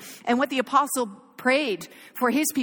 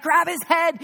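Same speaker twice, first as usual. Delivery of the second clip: urgent order; 6 semitones higher, 4 dB louder, slower than usual. Retold or imitated. Mother urging son during struggle.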